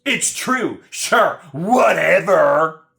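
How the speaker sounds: high, loud, drawn-out sarcastic brush-off. Retold or imitated. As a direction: dismiss it with a sarcastic drawl. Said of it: "you being sarcastic right now".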